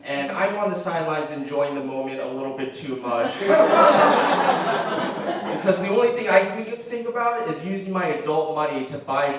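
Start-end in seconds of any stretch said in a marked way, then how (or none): 3.4-5.5 high loud burst of laughter; real laugh at own meanness. Real, not acted.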